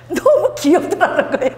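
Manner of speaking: tickled retelling ending in laughter; amused laughing. Retold or imitated. Retelling how cute the commentator found the prayer, laughing. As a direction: laugh through the words. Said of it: "막 웃으면서 이렇게 얘기하는 거예요".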